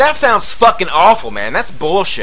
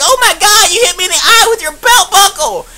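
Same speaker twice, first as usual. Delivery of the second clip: put-on shrieking old lady; 13 semitones higher than usual. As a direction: shriek it like a little old lady hit in the eye. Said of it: "one old lady was like".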